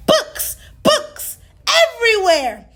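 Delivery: ordinary delivery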